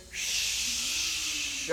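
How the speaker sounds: long hushing wind sound; wind noise. Pretend. Preacher leads the congregation making wind, long hissing shh. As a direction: blow like wind with a drawn-out shh. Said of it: "we gotta do the wind again".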